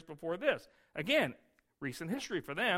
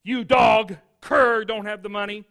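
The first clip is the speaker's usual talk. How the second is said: loud furious insult; the tax collector berating the man he just slapped; angry insult; 4 semitones higher, 13 dB louder, slower than usual. Retold or imitated. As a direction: spit the insult at him. Tax collector insults after slap.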